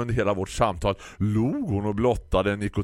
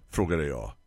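ordinary delivery

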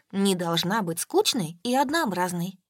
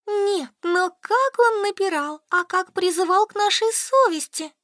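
laughing; 11 semitones higher, 5 dB louder than usual. A narrator's reading. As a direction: talk through laughter, much higher. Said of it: "смеялась Солька".

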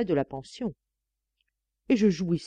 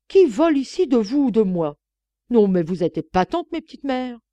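loud, higher scornful shouting; angry cry; 5 semitones higher, 8 dB louder than usual. Acted. Maid shouting at the nuns, loud and scornful. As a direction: shout the accusation back at her. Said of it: "m'écriai-je".

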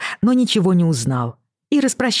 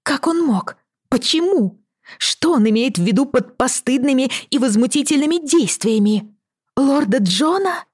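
distressed; 10 semitones higher than usual. A narrator's reading. Cousin panics over arrest letter.

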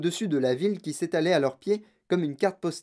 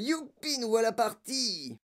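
high, drawn-out cheer, held back in volume; elated high whoop; gleeful cry; 7 semitones higher, 4 dB quieter, slower than usual. A narrator's reading. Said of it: "cria encore grand-papa Joe".

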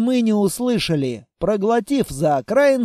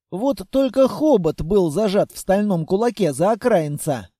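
ordinary delivery

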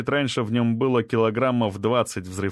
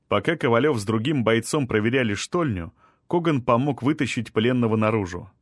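ordinary delivery, nothing marked

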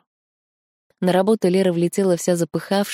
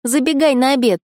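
put-on much higher, chirpy girlfriend voice; bright friend voice; 8 semitones higher, 4 dB louder than usual. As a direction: pop in curious and high. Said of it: "Таня".

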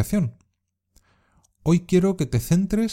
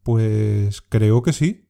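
very slow, hesitant "Pues creo que sí.", dragging the "Pues"; unsure, stretching each word while thinking; doubtful; much slower than usual. Shown as a demonstration. Teacher modelling doubt for learners, very slow and hesitant. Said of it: "cuando tiene el significado de duda".

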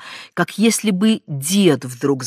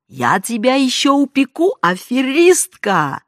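threatening shriek; 8 semitones higher, 6 dB louder than usual. A narrator's reading. Aunt screams after her.

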